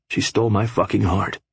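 ordinary delivery

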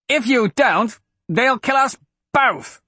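high, forceful desperate shout; harsh shout. A narrator's reading. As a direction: shout harshly to make the child obey. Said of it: "Wyatt shouted harshly".

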